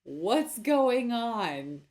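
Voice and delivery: slow, loud dramatic questions; melodramatic confusion. Pretend. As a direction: overact being lost.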